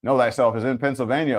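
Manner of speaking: usual speaking voice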